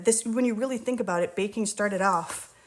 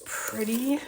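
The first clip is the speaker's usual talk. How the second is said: whispering; 3 semitones higher than usual. Real, not acted. Admiring the smooth dough.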